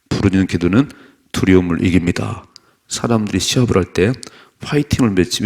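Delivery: loud, declarative preaching; forceful sermon reading. Real, not acted. Preacher reading the point on praying aloud, forceful. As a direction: proclaim each point firmly and loudly.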